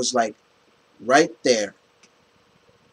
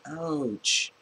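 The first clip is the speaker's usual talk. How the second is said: E.T. voice; 2 semitones higher, 4 dB quieter, much slower than usual. Retold or imitated. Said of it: "I'm doing E T".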